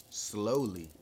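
usual speaking voice

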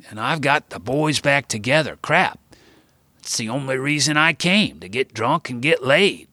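a hint of a boastful grandpa; Gramps voiced. A narrator's reading. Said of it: "speaking to everybody by way of volume".

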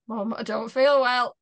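imitating a poorly child nagging mum; insistent complaint. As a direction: press mum harder for attention. Repeats complaint to distracted mum.

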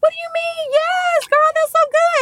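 very high excited imitation of mom; thrilled mom. Mom cheering the pregnancy news, thrilled squeal. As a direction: squeal it with overflowing delight. Mom's excited reaction, acted.

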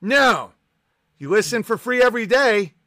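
ordinary talk, nothing marked

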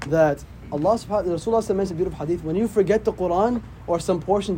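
usual speaking voice

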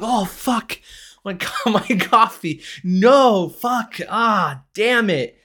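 high, loud, hammed-up wailing; fake dismay. Boy faking an accident with his coffee, loud mock despair. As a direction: cry out in put-on anguish, half laughing. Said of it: "I trip on purpose".

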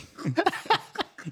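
high squealing laugh. Real, not acted. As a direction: crack up high. Laughing at story.

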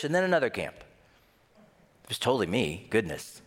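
ordinary talk

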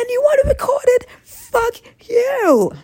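mock outraged cry. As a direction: tear into him, hammed up. Outburst at imagined man.

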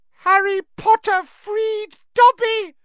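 very high, loud, shrill; shrill elf. Acted. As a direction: squeal it with joy. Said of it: "said the elf shrilly".

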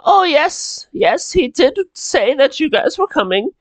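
put-on high-pitched elderly maid; old maid. Old maid tells guests about her master, high old-lady voice. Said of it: "she's old and she's, like, holding a cane".

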